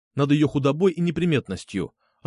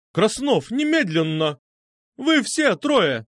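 high, commanding bark; barked order; 9 semitones higher, slower than usual. A narrator's reading. Medusa summons the three boys, barking. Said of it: "рявкнула".

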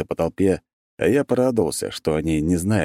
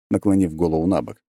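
plain reading voice

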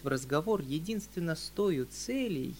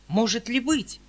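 high, loud startled outcry; sudden fearful exclamation, higher and louder; frightened cry; 8 semitones higher, 8 dB louder than usual. Acted. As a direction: gasp it out in alarm. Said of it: "вскрикнул дядя в испуге".

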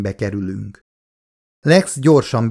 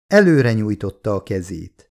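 plain reading voice

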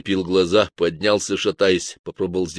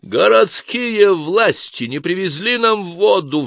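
slow, high, loud furious roar; 12 semitones higher, 6 dB louder, slower than usual. A narrator's reading. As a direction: bellow the bad news out. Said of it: "взревел инквизитор".

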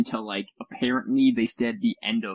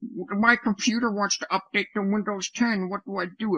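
much higher, worried pleading voice; a lightly mocked helpless housemate; panicked housemate; 10 semitones higher than usual. Host imitating a household member asking for help, high and worried. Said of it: "they're just like".

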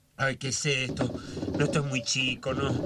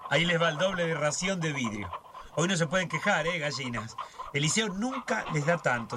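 normal talking voice

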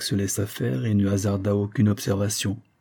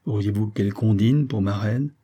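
ordinary delivery

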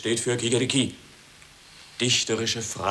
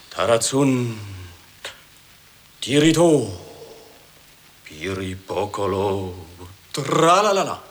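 poem recital; 5 dB louder than usual. Acted. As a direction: recite with rising passion. Poet declaims his poem.

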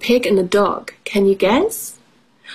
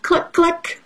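high, short demo clucks; playful pitched-up clucking; chicken noise; 9 semitones higher than usual. Shown as a demonstration. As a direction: show children how a hen clucks. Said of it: "what noise does the chicken make".

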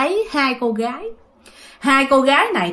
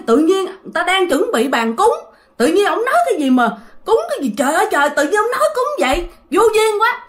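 exasperated indignation; 8 semitones higher than usual. Retold or imitated. Grandma's exasperated indignation retold.

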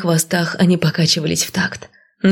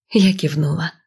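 usual speaking voice

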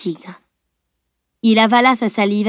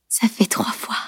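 weak whisper of a wounded woman; labored whisper; 7 dB quieter than usual. A narrator's reading. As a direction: force the words out in a faint whisper. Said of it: "articula-t-elle avec peine". (murmure faible, articulé avec peine)

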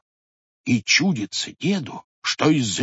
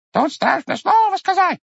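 much higher, louder squeaking beak; squeaky bird beak; 5 dB louder than usual. Acted. Bird's beak mocking grandfather, high squeak. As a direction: squeak it like a tiny bird's nose. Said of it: "пискнул птичий нос".

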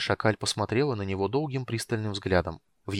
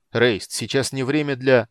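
louder, slower irritated protest; 3 semitones higher, 7 dB louder than usual. A narrator's reading. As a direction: object with mild irritation, loud and deliberate. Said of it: "раздражённый полуэльф". (раздражённо, с нажимом)

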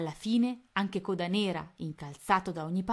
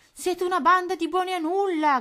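high, loud furious yell; furious shout; 12 semitones higher, 10 dB louder than usual. A narrator's reading. Tony scolding the useless mice, furious shout. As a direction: bellow it at the useless troops. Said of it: "gridò".

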